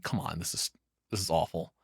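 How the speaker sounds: ordinary talk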